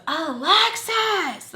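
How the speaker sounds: high, drawn-out mock yell; annoyed yell. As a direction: imitate an annoyed call for someone, stretched out high. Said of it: "she just yells".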